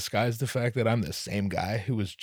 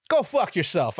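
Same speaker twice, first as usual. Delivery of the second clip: louder, higher go at the listener; playful louder jab at the audience; playful mock outburst; 8 semitones higher, 5 dB louder than usual. Real, not acted. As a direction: jokingly snap at grumblers. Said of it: "mock insult to listeners".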